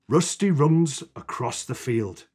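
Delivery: plain reading voice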